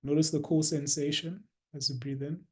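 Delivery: soft, unhurried meditation guidance with long pauses; guided meditation. Real, not acted.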